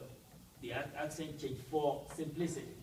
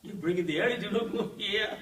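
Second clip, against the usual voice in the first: mocking quote; 6 semitones higher, 6 dB louder than usual. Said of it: "guys will look at you twice and say".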